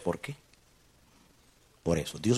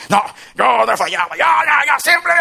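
loud, high, shouted boast; a proud father's loud protest, hammed up; mock proud denial; 13 semitones higher, 9 dB louder, slower than usual. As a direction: shout it like a man who never admits fault. Mocking a proud self-righteous father.

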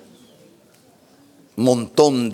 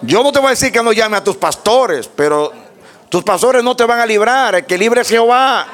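raised, emphatic pulpit delivery; fervent preaching; 13 semitones higher, 4 dB louder than usual. Real, not acted. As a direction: drive the exhortation home, rising to a cry. Preaching at full force. (predicación fervorosa con voz elevada)

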